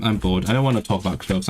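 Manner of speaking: ordinary delivery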